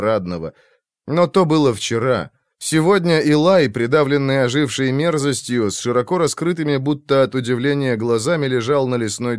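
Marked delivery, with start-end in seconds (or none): none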